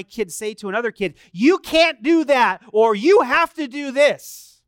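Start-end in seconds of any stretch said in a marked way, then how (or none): 1.3-2.6 put-on loud, high, drawn-out child's order; imitating an emphatic kid. 2.9-4.6 put-on loud, high, drawn-out child's order; imitating an emphatic kid.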